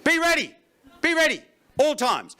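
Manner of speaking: urgent. Real, not acted. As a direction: drill it into them like a coach. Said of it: "urging the audience".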